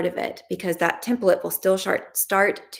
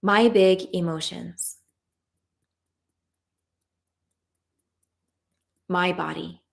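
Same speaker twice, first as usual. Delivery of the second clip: tapping script. Acted. Coach leading a tapping round, calm guided voice. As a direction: lead the group through the affirmations calmly.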